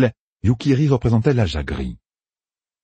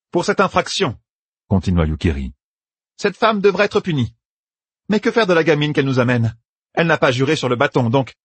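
severe judge; 8 semitones higher than usual. Acted. Yukiri judging Meidani and Egwene, severe. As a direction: pass judgment coldly.

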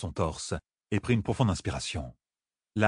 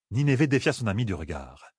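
ordinary delivery, nothing marked

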